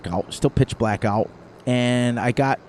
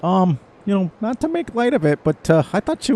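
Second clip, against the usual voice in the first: higher, lightly voiced hesitant friend; 8 semitones higher than usual. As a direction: tiptoe around it like a cautious friend. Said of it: "and he's like".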